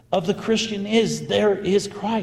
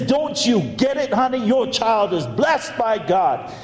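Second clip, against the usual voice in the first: higher, slow, imitated Louisville drawl; thick Southern drawl; 4 semitones higher, slower than usual. Friend telling birthday woman she is blessed, imitated Southern drawl. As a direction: drive it home as an emphatic Southern woman. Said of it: "in her thick Southern Louisville drawl".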